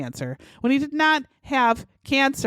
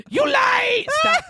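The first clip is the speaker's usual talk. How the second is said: much higher shouted yell; mock samurai shout; 4 dB louder, slower than usual. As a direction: shriek it like the fake samurai. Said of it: "in that voice".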